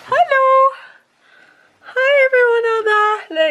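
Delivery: high, loud sing-song hello; excited greeting. Real, not acted. Vlogger greeting viewers from the mezzanine, high excited call.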